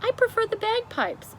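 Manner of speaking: high prim retort; high queen voice. Acted. The queen dismisses the music, high and prim. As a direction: lift into a prim royal voice.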